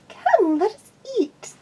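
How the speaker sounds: high mare voice calling out over music; high-pitched yell to be heard; character voice, yelled. A narrator's reading. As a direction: call out high and bright over the noise. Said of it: "she yelled into my ear".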